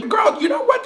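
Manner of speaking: sudden high shout; a hammed-up shriek of outrage. [name] rants about lying men.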